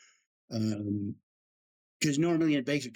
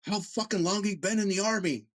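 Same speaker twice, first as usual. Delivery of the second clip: higher, heated chewing-out; a commander tearing into a specialist; angry commander; 7 semitones higher than usual. Retold or imitated. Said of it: "he goes".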